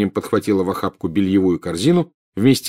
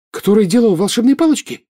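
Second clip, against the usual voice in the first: put-on high-pitched, louder girl's alarm; frightened question; 13 semitones higher, 5 dB louder than usual. A narrator's reading. Джинни asks father about the wand maker, frightened. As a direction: ask it in fright, voice jumping up. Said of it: "испуганно спросила Джинни".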